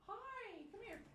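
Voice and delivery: squeaky pet voice, hushed; dog-calling voice. Real, not acted. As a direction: coo up high to call the puppy.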